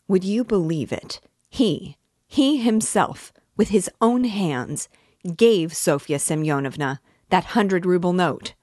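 loud, high-pitched, insistent testimony; impassioned accusation. A narrator's reading. Witness accuses the man before everyone, impassioned. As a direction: hammer it out to the whole room. Accuser swears to all he saw.

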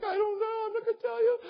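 very high tearful wail, imitated; mock crying. Crying daughter refusing to explain to her dad, mock wailing. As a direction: wail it like a crying little girl. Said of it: "she was still crying".

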